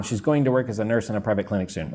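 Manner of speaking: fast run-through of the sentence; rapid connected speech, little words reduced; quick normal talk. Shown as a demonstration. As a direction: rattle it off in one breath. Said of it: "if I say it quickly".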